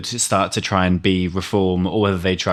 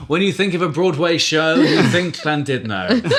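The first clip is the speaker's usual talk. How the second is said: laughing; 7 semitones higher than usual. Real, not acted. Mocking Broadway glamour.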